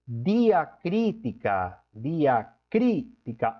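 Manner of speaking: "diacrítica" said slowly twice, each syllable clear; slow word demo. Shown as a demonstration. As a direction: model "diacrítica" slowly for students. ("diacrítica" pronunciada devagar, duas vezes)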